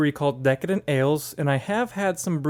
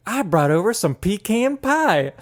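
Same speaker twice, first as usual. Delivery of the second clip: slow, higher acted sitcom line; quoted dinner-guest teacher; 5 semitones higher, 3 dB louder, slower than usual. Retelling a teacher arriving for dinner, slow hammed-up delivery. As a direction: draw it out, landing on pee-CAN pie. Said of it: "He's like".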